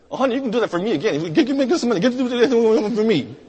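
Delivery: high, very fast comic pleading; eager cashier. Retold or imitated. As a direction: gabble it into a pile-up of words. Cashier eagerly jokes for money.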